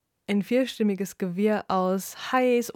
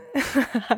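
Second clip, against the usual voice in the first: laughing; 6 semitones higher, 4 dB louder than usual. Acted. Laughs before joke reveal.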